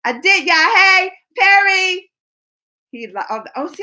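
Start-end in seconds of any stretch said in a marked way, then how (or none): 0.7-2.1 drawn-out greeting. Real, not acted.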